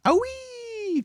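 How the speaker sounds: squealing ouch, very high; high pained yelp. A narrator's reading. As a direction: cry out as you are knocked over. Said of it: "cried the crocodile".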